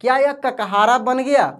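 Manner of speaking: ordinary talk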